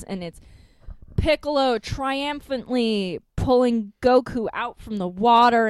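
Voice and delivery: ordinary delivery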